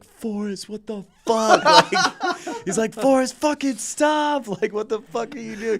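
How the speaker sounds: high, loud, frantic yelling through laughter; hammed-up freaked-out bandmate yelling from the back seat; panicked drummer. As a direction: shriek at the driver in alarm. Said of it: "And Garrett, our old drummer's in the back".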